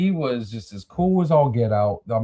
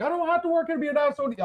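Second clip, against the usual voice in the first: retold retort; much faster than usual. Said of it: "I'm like".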